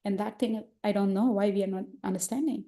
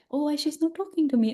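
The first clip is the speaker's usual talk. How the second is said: higher, mildly acted puzzlement; imagined hurt wondering; 6 semitones higher than usual. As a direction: wonder why you are being ignored. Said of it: "maybe she will feel".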